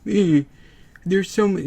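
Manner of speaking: mock whiny teen; whiny Eli. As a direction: whine to mom like a kid. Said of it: "And he's like".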